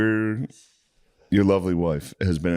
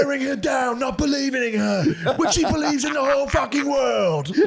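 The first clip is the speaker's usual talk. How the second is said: mock hype guy shouting. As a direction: yell like an overwrought video preacher. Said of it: "He's in his car like".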